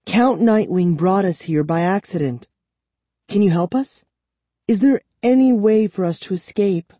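put-on high, earnest child's voice; pleading young girl. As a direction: appeal for help, pitched high.

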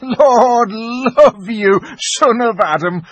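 high, loud, drawn-out mirthful exclamation; uproariously amused, stretching the words; great hearty laugh. A narrator's reading. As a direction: crow with amusement at a silly idea. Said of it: "with a great laugh".

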